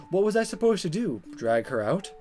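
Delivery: usual speaking voice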